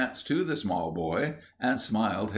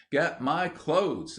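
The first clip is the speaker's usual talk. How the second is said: slow, higher, indignant order; irate; 6 semitones higher, slower than usual. A narrator's reading. Boy in the ditch orders his friend, irate. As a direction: stretch out each word in mild anger. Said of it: "said the irate voice from the ditch".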